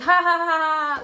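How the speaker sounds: stiff "ha ha ha" laugh, lips barely moving, pitch up; ventriloquist dummy laugh. Shown as a demonstration. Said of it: "if I laugh like a ventriloquist dummy".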